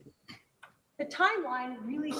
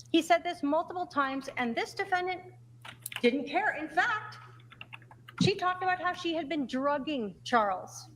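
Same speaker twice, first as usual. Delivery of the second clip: passionate closing argument; 5 semitones higher than usual. Real, not acted. Prosecutor's emphatic closing argument.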